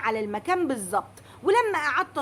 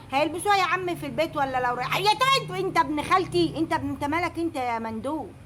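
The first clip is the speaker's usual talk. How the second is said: ordinary talk, nothing marked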